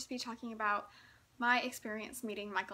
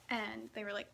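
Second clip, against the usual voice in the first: ordinary delivery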